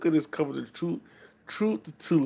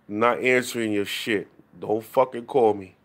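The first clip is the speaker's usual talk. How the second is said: ordinary delivery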